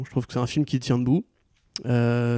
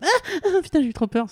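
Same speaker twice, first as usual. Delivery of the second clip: high-pitched fake fright squeal; mock scared teen. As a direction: squeal like a jumpy teen at a slasher. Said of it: "des gens qui sursautent en mode".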